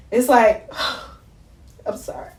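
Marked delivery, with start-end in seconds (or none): 1.3-2.3 very soft apology after a sigh; overcome, near tears. Real, not acted. Apologising to viewers as she breaks off, real tearful hush. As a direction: barely get the words out, fighting real tears. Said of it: "I'm trying not to cry".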